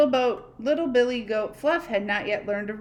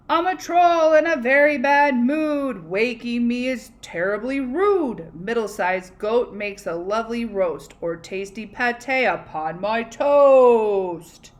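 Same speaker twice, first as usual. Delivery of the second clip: grumpy troll; 2 semitones higher, 8 dB louder, slower than usual. Acted. Troll roaring at little goat to get off his bridge. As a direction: bellow it like a cranky troll.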